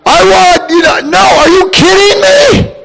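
ordinary delivery

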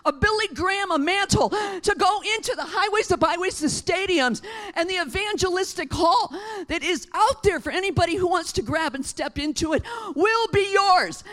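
loud, high proclamation; rally them with the evangelistic call; preaching at full force. Acted. Pastor shouting the evangelistic call to the church, full force preaching.

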